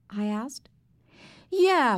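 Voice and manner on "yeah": put-on high, loud small child; little boy Fudge. A narrator's reading. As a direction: pipe up like an indignant little boy. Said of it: "Fudge said".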